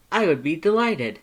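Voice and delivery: high, loud eager reply; delighted Papyrus. Acted. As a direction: accept up high with gusto. Papyrus accepts invitation.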